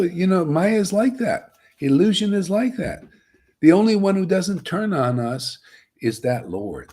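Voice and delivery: ordinary talk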